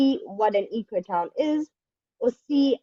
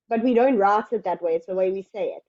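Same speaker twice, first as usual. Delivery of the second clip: usual speaking voice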